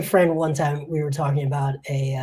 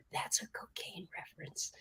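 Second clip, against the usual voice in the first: whisper; 3 semitones higher, 17 dB quieter than usual. Retold or imitated. Retelling reporter's note, whispered.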